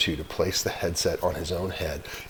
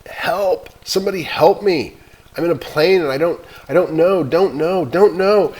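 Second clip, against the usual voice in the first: much higher, much louder panicked screams for help; panicked screaming; 10 semitones higher, 9 dB louder than usual. Acted. Boy screaming into the radio for help, panicked. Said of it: "he began to scream into the microphone".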